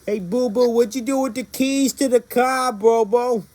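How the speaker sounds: a hammed-up New Yorker asking for the car keys; mock New York accent. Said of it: "That's not what we sound like".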